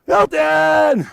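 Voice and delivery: high shout of the name; screaming his name. Retold or imitated. Nurse yells again at the bedside, high shout. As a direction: call him back with a yell. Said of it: "Screaming his head off".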